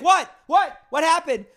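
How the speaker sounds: high, loud startled voice; panicked bystander; alarmed questions. Retold or imitated. People at his house reacting to his scream. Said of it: "They're like".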